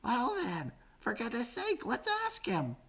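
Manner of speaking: high, soft urging; eager little voice pressing on; small joey impatient; Freddy's voice. A narrator's reading.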